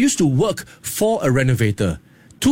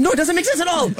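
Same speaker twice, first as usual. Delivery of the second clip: high baffled friend; faster than usual. Retold or imitated. Friends answering, pitched up.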